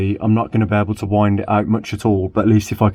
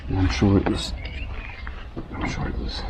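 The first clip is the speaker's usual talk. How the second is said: very soft, almost whispered repeat; muttered to himself; 9 dB quieter than usual. Real, not acted. Recalling the job to himself while prying trim, barely voiced mutter. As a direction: murmur it under your breath.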